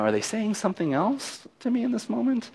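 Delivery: high, softened voice of second-guessing; mock doubt. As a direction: question it high and soft, playing unsure. Continues acted second-guessing.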